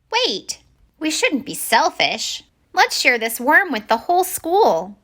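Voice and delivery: high, small fish voice, urging kindly; a tiny fish reminding others to share; fair-minded little minnow. Acted. Minnow telling his friends to share the worm, high small voice.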